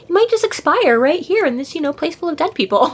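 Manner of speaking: high-pitched imitation of a creepy fairy; lightly hammed-up creepy suggestion; creepy mock sympathy. Creepy fairy toying with hurt wizard, mock-sympathetic. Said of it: "she's just all creepy".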